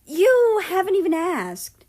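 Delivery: high, loud shot back; excited retort. A narrator's reading. Japan shooting back at America, high and excited. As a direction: fire it back eagerly. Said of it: "the excited country shot back".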